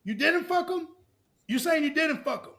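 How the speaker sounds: retelling a girl accusing her friend, high mock outrage. Accusing girl quoted in argument.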